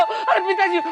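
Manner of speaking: high-pitched urgent rousing; agitated waking call. Acted. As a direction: rouse the family in startled agitation. Urgently waking family in temple. (हड़बड़ाकर, ऊँची आवाज़ में जगाते हुए)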